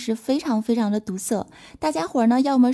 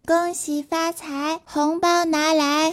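put-on kids asking for red envelopes; 4 semitones higher, much slower than usual. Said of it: "他们都说".

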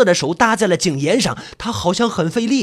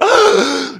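high, sharp gasp of fright; startled gasp; 7 semitones higher, 5 dB louder than usual. Acted. Narrator seeing who climbs out, shocked gasp.

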